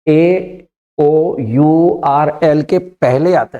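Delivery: slow, deliberate listing of the letters; teacher repeating a rule slowly; slow dictation. Shown as a demonstration. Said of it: "फिर देखिए".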